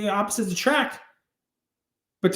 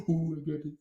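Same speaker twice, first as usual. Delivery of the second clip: usual speaking voice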